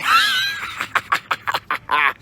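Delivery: shrieking monster cackle; a wild, sky-high cackle from the water beast; evil cackle. A narrator's reading. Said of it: "And the kelpie said".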